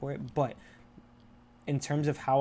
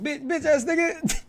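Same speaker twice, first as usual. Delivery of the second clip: shouted high and slow, playfully insulting; mock outburst; 8 dB louder, slower than usual. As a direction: hurl the insult at the brothers like a joke. Retold insult to brothers.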